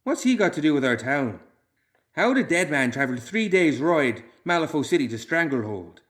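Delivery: suspicious townsman asking the others; questioning villager voice; Loomis, lightly voiced. A narrator's reading.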